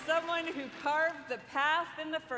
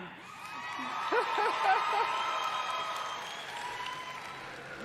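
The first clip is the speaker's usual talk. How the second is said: high giddy laugh. Real, not acted. Laughs after shout out.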